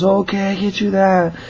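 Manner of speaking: high-pitched mimicry; caller's put-on voice. Caller promising the manager candy, high put-on voice. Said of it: "And he goes".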